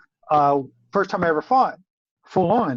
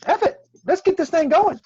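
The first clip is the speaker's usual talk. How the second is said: psych-up cry; 11 semitones higher than usual. Retold or imitated. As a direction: fire yourself up before stepping on the field. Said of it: "I go".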